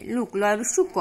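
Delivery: লুক with a clear u vowel. Shown as a demonstration. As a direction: say লুক, not লোক. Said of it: "উচ্চারিত হচ্ছে লুক হিসাবে". (ওকার উকার হয়ে লুক)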